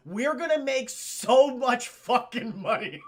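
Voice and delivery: loud, slow, relishing glee; mock excited. Lawyer dreaming of profits, acted with loud glee. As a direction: gloat with drawn-out excitement. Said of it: "Oh, he's so excited".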